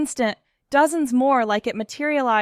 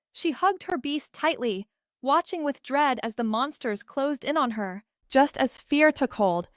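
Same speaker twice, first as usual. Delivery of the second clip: usual speaking voice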